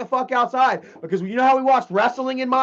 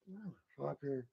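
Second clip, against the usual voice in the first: muttered curse; 8 semitones lower, 21 dB quieter than usual. Retold or imitated. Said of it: "I'm like".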